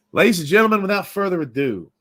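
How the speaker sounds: announcer intro. Acted. Host introducing the guest.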